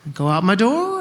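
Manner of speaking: ordinary talk, nothing marked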